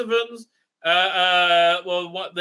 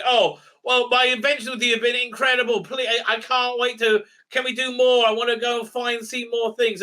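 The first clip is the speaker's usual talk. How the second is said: higher, breathless-fan imitation; mock gushing enthusiasm; 5 semitones higher than usual. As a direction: gush eagerly, tumbling over your own requests. Mocking an eager companion's gushing.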